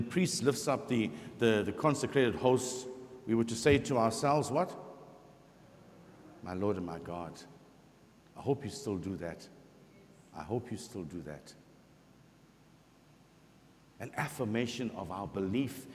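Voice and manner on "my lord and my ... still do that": usual speaking voice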